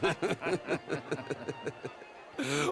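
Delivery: a sneering chuckle; mocking laugh. Host mocking a guest to the cowboys, sneering laugh. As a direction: laugh at the fool.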